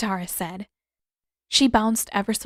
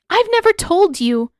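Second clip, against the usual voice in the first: indignant surprise; 11 semitones higher, 9 dB louder than usual. A narrator's reading. Katara's shocked demand continues.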